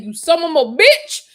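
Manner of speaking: mock yell of a curse; retold outburst. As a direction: fling it out as the fallen man. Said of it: "once he hit the floor and said".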